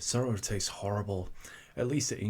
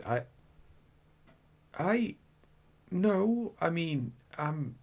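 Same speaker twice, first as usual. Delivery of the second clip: flustered stammer; 7 semitones higher, slower than usual. Acted.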